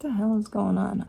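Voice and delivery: usual speaking voice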